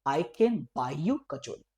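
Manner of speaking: slow, clear teacher demo; slow drilled answer; slow model reply. Shown as a demonstration. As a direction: repeat it slowly for the class. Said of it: "sentence क्या हो जाएगा reply में?".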